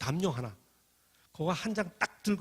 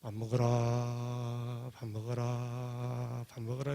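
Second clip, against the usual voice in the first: very slow, stretched chant; slow bell rhythm; 4 semitones lower, much slower than usual. Shown as a demonstration. Preacher demonstrating the meal bell, very slow chant. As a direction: toll it out slowly like a meal bell. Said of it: "좀 천천히 쳐야 됩니다".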